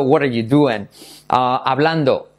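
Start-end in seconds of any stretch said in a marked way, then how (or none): 0.0-0.9 linked "what are you", then "doing" as "du-en"; everyday "what are you doing", ending in "DU-en"; doing like duende. Shown as a demonstration. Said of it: "Por lo tanto".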